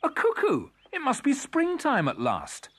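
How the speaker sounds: put-on high, excited child's exclamation; loud cry of delighted discovery. A narrator's reading. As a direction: shout it high, like a thrilled child. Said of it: "cried one".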